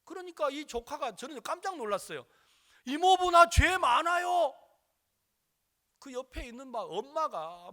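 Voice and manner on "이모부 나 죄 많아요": put-on loud, high, slow child's cry; child confessing. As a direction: call it out like an earnest child. Child confessing to uncle in story.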